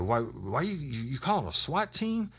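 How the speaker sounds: stammering high-pitched imitation; mock a nervous suspect, voice climbing high; incredulous, high acted question; worried suspect.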